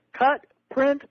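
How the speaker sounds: high-pitched mock director's yell; retold yell. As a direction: call it out high, imitating an excited yell. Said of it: "and yells".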